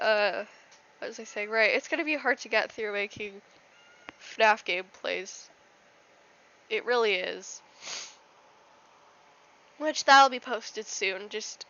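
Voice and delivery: ordinary delivery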